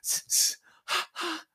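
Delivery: rhythmic unvoiced breaths; panting while running. Tomohiro jogging along the highway, rhythmic panting. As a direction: breathe in-in, out-out as you jog.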